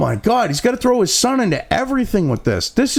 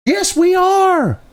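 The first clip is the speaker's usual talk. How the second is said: much higher, slow, shouted; shouted retort; 11 semitones higher, 3 dB louder, much slower than usual. Real, not acted. Answering the fake denial with a slow shout. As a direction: bellow it back at them.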